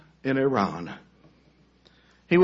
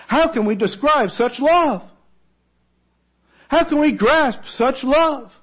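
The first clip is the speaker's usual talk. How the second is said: high, building awe; rising wonder; 9 semitones higher, 3 dB louder than usual. Real, not acted. Preacher marvels at God's love to the congregation, rising in awe.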